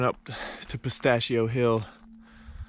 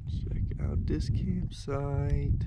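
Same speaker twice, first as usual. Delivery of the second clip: "campsite" stretched out slowly, voiced aloud; drawn-out showing off; 3 semitones higher, much slower than usual. Real, not acted. Camper showing off the campsite to viewers, slow and drawn out. Repeats line aloud after whispering.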